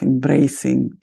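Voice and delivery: normal talking voice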